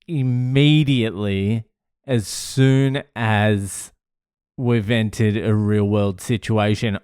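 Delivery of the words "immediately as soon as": ordinary delivery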